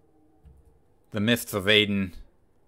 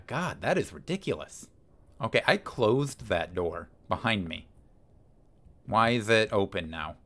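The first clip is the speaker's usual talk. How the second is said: normal talking voice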